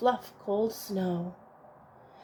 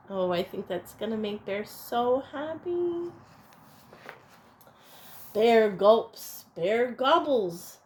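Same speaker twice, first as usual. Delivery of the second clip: plain reading voice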